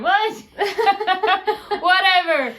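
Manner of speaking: high, laughing What? into a giggle; bursting into laughter. Real, not acted. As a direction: crack up mid-sentence. Laughing at her own joke.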